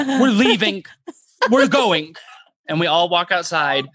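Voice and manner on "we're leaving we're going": raised, decisive mock line; firm exit. Brother playing himself leading them out, mock firm. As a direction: announce it and walk out.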